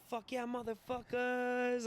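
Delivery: high, drawn-out mock holler; imitated battle yell. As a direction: holler it slowly at the group, held back in volume. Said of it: "I'm, like, yelling shit".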